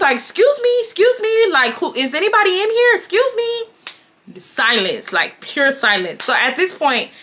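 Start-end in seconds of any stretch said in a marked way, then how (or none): 0.2-3.6 high-pitched imitation of calling through a door; high singsong call to whoever is inside. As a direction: pitch it way up, mimicking an insistent friend. Friend calling through dressing room door.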